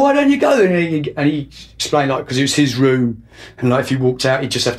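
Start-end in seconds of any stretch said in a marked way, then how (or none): 0.0-0.7 exasperated retort. Retold or imitated. Fed up with his bandmate refusing to leave, a sharp high retort. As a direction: fling it back at him, fed up.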